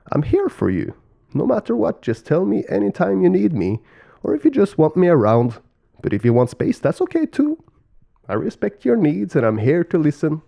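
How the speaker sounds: high, eager turkey character voice; kindly turkey, devoted. Acted. Turkey wooing the ladybird, high-pitched and devoted. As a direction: offer support in a high-pitched voice.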